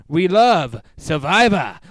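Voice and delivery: loud, punchy "We love Survivor" shouted out. Acted. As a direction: belt "We love Survivor" like a rally cry. Repeats the line shouting.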